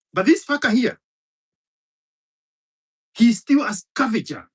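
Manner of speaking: rising fury at the listener; scornful shout; angry outburst. Real, not acted. Angry rant against Kikuyu.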